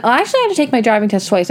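ordinary talk

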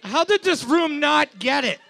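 high-pitched, exasperated disbelief; incredulous. Real, not acted. Quizmaster chiding the room for missing it, incredulous. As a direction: voice jumps up in baffled protest. Baffled nobody got the answer.

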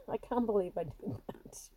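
whispered through a laugh; embarrassed whisper. Real, not acted. Admitting her past to viewers, whispered laughing. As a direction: breathe it out, half laughing at yourself.

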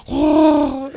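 very high comic wail; high, drawn-out mock groan; falling noise imitation. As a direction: imitate a big man tumbling into a ditch. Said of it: "that's all you hear is him fall".

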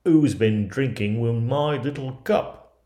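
loud, slow put-on dwarf voice; storybook dwarf, loud and deliberate; indignant dwarf. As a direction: draw out the complaint as a put-out dwarf. Seventh dwarf finds his cup used.

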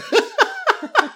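high squealing laugh. Real, not acted. Laughing high at a movie skeleton. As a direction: break into a high giggle.

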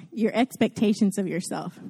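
ordinary delivery

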